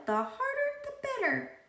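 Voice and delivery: high, squeaky talking pigtail. A narrator's reading. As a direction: play the cheeky pigtail, way up high.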